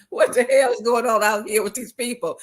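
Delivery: a touch of exasperated disbelief; mock exasperation. Stepping out the door, mock exasperated at people. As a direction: lightly act fed up with the crowd outside. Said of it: "you're like".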